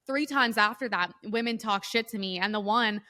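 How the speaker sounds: ordinary delivery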